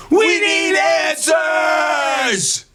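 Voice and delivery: high, very slow shouted demand; hammed-up outrage; mock demand. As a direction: demand it with fake urgency.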